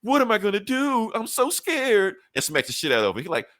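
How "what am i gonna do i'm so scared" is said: high, drawn-out fake panic; mock scared. Tony facing a giant robot, mockingly terrified. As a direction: ham up a sarcastic show of terror.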